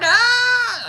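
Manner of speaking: very high, loud mouse scream; loud mouse shriek. Retold or imitated. Terrified mouse screaming loud and high at the man. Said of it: "He's like".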